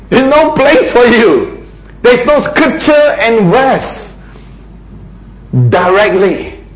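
high, slowed, sing-song brush-off; mocking dismissal. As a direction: toss it off high and slow, as if amused. Preacher voices mocking dismissal.

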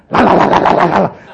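comic rapid-fire "la" babble; one-syllable tongues. Shown as a demonstration. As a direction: burst into loud repeated la's. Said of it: "if your tongues got one syllable".

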